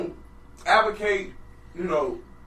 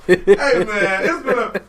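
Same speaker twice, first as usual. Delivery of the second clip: usual speaking voice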